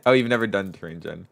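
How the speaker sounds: ordinary talk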